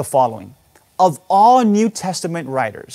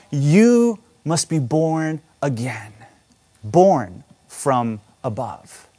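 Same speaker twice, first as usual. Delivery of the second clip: slow emphasis; 3 semitones lower, slower than usual. Acted. Preacher stressing the key phrase.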